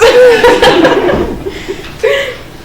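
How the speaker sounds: laugh. Real, not acted. Laughs at own joke.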